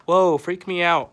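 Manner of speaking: loud startled whoa; startled shout. Real, not acted. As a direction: shout in surprise. Startled by the prank.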